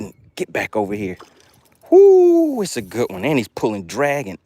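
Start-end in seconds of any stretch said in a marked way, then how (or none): 1.9-2.6 long high, loud whoo; excited whoop. Real, not acted. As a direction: whoop for the big catch. Angler whoops at big fish.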